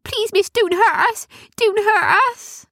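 cower and plead; whimpering gnome. Acted. Gnomes hiding behind a rock, whimpering high. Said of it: "they whimpered".

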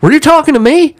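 loud, high drill-ground challenge; Marine coming out. Retold or imitated. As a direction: demand it like an affronted Marine. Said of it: "I saw the Marine come out of him".

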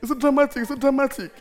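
high, loud teasing singsong; family mocking. Family at home teasing the preacher, high and loud mockery. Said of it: "every little night they say".

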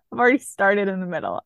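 ordinary delivery

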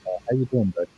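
muffled, like underwater. Real, not acted. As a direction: sounds like a bad mic. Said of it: "You sound like you're underwater".

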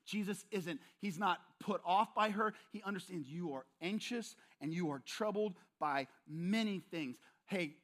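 usual speaking voice

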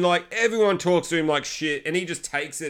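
ordinary talk, nothing marked

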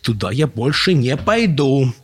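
high, slow, loud declaration; emphatic balking; firm refusal. A narrator's reading. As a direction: refuse stubbornly, stretching the words. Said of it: "заявил он".

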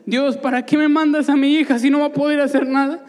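father cries out to God, loud and high; anguished outcry. Retold or imitated. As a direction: demand an answer from God in anguish.

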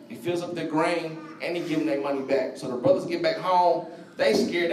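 usual speaking voice

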